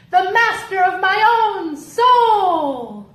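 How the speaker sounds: high, loud and slow; grand declamation. Acted. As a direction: swell up like a hero.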